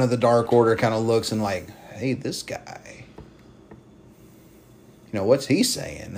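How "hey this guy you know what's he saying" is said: whispered aside to the group; hushed murmur. Retold or imitated. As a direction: lean in and whisper it. Cult member murmuring to others.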